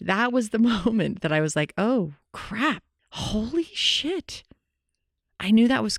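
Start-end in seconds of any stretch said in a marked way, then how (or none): 1.8-4.4 mock dismay, much higher and drawn out slowly; the penny drops, a hammed-up oh no; startled realization. Retelling her sudden empath realization, stretched high dismay.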